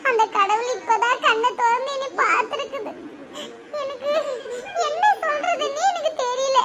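high-pitched acted weeping; overwhelmed, tearful wail of gratitude; crying with joy. Mother-in-law thanking God for a grandchild, crying. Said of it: "சரி, சரி, அழாதீங்க".

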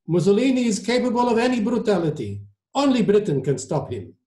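put-on high-pitched Italian-accented pronouncement. A narrator's reading. Maestro answers about Mussolini, Italian accent. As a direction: warn them in an Italian accent. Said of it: "in his heavy Italian accent".

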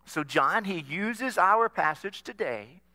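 ordinary talk, nothing marked

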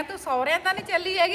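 high, playful teasing ask; teasing question. Retold or imitated. Teasing the crying Rekha, high playful question.

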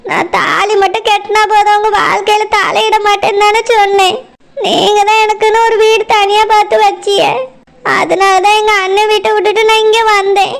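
timid second wife explaining herself to her husband; meek second wife. Acted. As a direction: speak humbly, close to tears. Second wife voiced by same speaker.

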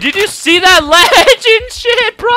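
high, loud, drawn-out excitement; amazed shouting. Real, not acted. Player amazed by a legendary play, excited shout. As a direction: shout in awe, stretching the words.

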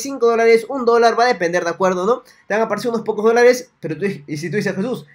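ordinary delivery

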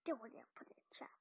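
fast soft whisper aside. Real, not acted. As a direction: whisper quickly to the viewers. Aside to viewers.

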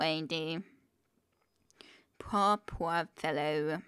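usual speaking voice

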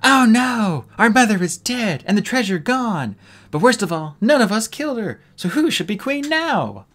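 mock anguish. Tsunami acting a grieving princess, loud hammed-up anguish. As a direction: wail over the dead mother, overacted. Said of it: "let out a cry of anguish".

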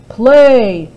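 loud booming order; roared command. Acted. Giant ordering the harp to play, roaring. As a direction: bark it like a giant. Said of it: "he roared at the harp".

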